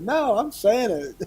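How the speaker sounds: high, laughing "No, I'm Santa"; laughing Santa denial. Retold or imitated. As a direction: fight back the giggles as Santa. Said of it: "I said".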